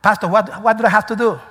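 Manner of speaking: mildly hammed-up plea for help; anxious congregant's question. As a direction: play a worshipper appealing to the pastor. Voices a worshipper asking the pastor.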